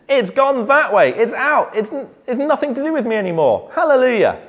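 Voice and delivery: high, fast, delighted burst of joy; joyful excited Israelite. Retold or imitated. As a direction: gush about your sins being gone. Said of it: "why they're smiling and say".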